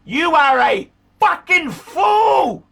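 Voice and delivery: loud, slow furious yell; angry shout. Real, not acted. Ranting at George over his watch dial, shouting. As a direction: bellow the insult word by word.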